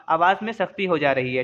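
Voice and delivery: normal talking voice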